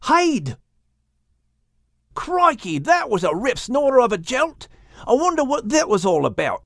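high, bright Australian character voice; high Aussie kangaroo voice. Acted. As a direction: play Boomer the excitable kangaroo. Boomer kangaroo voice.

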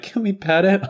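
put-on high-pitched child's request; pleading kid. Kids asking to pet the dog, high kid voice. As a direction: imitate an eager little kid asking.

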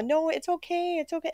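put-on high, hushed baby-talk comfort; imitating doting owners; cooing at a pet. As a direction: soothe a shaking dog. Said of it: "We're like".

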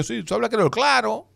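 high, teasing quoted voice; quoted questioner. Retold or imitated. Retelling how the man doubted his French, high mock voice.

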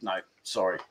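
quoting Annie's brush-off to the chat, low and quiet; Annie's refusal. Retold or imitated. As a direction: mumble a flat little brush-off under your breath. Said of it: "She said".

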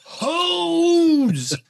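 out-of-breath call that only breaks loose on the last word; breathless retold yell. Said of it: "I'm still yelling".

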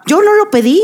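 high, outraged protest; indignant. Real, not acted. Indignant over unsolicited photo.

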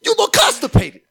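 high, loud pundit squeal; sports pundit voice. Acted. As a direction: shriek it like a hyped TV pundit. Joins the pundit bit.

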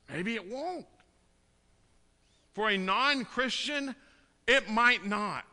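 rising sermon climax, pleading and loud; impassioned preaching. Acted. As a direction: preach it out to the congregation with fire. Preaching hard against false hope.

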